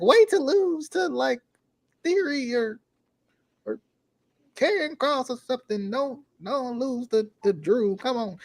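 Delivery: high, whiny exasperated fan; cheering voice. Retold or imitated. Fan nagging Roman during the match, high cheering voice. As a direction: plead and grumble up high at your wrestler. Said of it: "my cheering voice".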